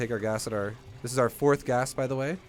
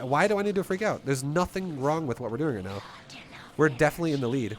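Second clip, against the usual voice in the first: normal talking voice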